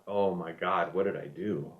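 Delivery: low, soft aside to oneself; retold private worry, voice dropped; worried inner thought. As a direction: think it aloud quietly and low. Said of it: "And I thought".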